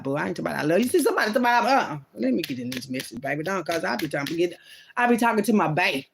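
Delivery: ordinary talk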